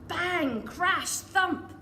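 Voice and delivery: loud, punchy sound words; bang crash thump. A narrator's reading. Reading the crash landing to a child, loudly. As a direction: boom out each landing word.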